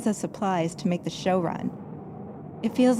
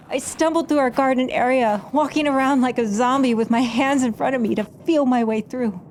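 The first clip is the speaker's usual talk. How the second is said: ordinary delivery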